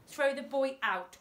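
impatient henchman commanding Dad; ordered. A narrator's reading. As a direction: order the boy thrown out. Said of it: "ordered Thumbs".

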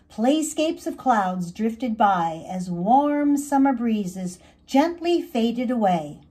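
ordinary delivery, nothing marked

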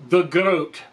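"groat" in a light Glaswegian accent; a quick touch of Glasgow on "the groat". Shown as a demonstration. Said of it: "I like to say that with a Glaswegian accent".

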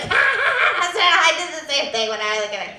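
high cackling laugh then quick chatter; laughing old lady. Retold or imitated. Old lady in the front row laughing at the mom. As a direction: play the amused grandma laughing along. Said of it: "burst into laughter".

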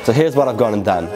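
ordinary talk, nothing marked

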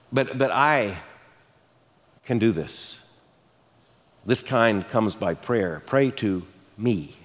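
low, slow and unhurried; tender assurance, spaced with pauses; kind reassurance. Retold or imitated. Jesus reassuring disciples who cannot do it, low and kind. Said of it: "Jesus is kind when they ask him".

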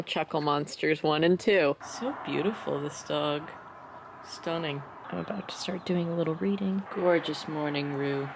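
hushed doting over the dog; quiet cooing. Real, not acted. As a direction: murmur fondly to the dog.

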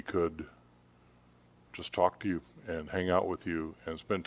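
soft, hushed prayer voice; quiet heartfelt closing prayer. Acted. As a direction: pray quietly and earnestly to God. Opening prayer before sermon.

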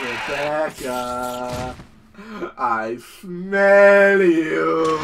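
slow, drawn-out menacing monster. Retold or imitated. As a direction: stretch every word like a hunting beast. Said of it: "He's like".

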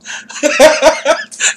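a burst of high-pitched laughter. Real, not acted. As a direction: laugh it off at the teasing. Friend teases him in chat.

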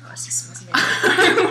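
laughing. Real, not acted. Tasting baby food, laughing.

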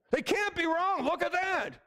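high mocking imitation of the doubters. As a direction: mimic the naive admirers, scoffing. Mocking what people say.

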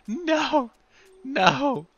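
high, loud cries of protest; pleading call. Real, not acted. Player calls the vanished character back, pleading. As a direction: wail after someone getting away.

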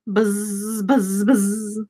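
low, soft buzzing fly; low, hushed insect buzz. Acted.

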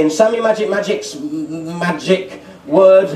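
usual speaking voice

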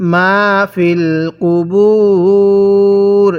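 very slow, with a long held u before the closing r; Quran recitation demo. Shown as a demonstration. Teacher modelling the verse ending, drawn-out recitation. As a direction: stretch the last word right out. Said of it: "কিভাবে পড়ব".

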